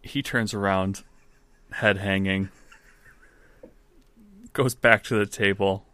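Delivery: usual speaking voice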